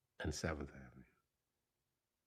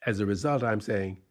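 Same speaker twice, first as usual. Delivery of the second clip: plain reading voice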